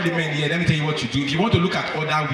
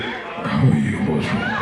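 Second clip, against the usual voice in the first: much lower, heavy seductive voice; dark deep voice; 13 semitones lower, slower than usual. Shown as a demonstration. Men wanting bad things, in a dark deep voice. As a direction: drop into a dark bass purr. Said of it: "the voice goes dark, deep".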